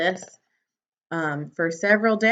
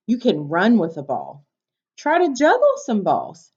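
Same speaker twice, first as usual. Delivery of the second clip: bright, lively storybook read; reading to children; 4 semitones higher, 3 dB louder than usual. Acted. Teacher reading a picture book to her class, bright and lively.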